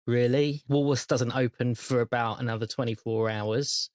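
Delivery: ordinary talk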